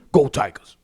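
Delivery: put-on low deep-swamp Louisiana coach accent; Ed Orgeron's accent. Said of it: "Ed's actual accent".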